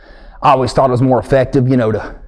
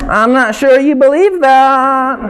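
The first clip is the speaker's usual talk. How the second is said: mock preacher, higher and a bit slow; 8 semitones higher, slower than usual. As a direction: doubt the crowd like a pulpit. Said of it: "preacher voice".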